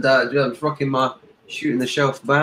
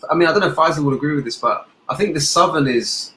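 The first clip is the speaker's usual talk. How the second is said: ordinary talk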